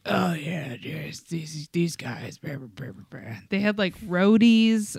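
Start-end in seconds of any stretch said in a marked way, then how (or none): 0.1-3.4 low, trailing-off roadie mumble; Road Dogg. Acted. Imitating the band manager who's been on the road, low trailing mumble. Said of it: "But he is just like".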